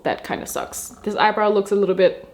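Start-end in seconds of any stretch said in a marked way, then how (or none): 0.0-0.9 low, unvoiced, under her breath; muttered disappointment. Real, not acted. Grumbling after running out of eyebrow pencil, voiceless and much lower. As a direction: mutter it to yourself, deflated.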